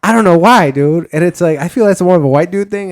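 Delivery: incredulous. Real, not acted. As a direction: marvel at your own antics. Incredulous at himself.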